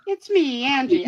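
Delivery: put-on high, lilting Italian-accented greeting; sing-song Italian mama accent. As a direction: announce yourself with playful Italian flair. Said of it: "that sounds so Italian to me".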